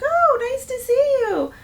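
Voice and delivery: high, loud, drawn-out mock greeting; gushing greeting. Leasing agent greeting a woman she recognized, gushing. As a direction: sing out a delighted hello, imitating someone.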